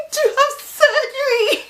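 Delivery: slow wail climbing to a squeal; hammed-up tearful wail; mock crying. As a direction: blubber it, pitch shooting up high. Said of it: "I'm crying".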